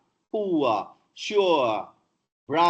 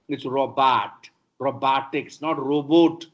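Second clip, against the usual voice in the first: ordinary delivery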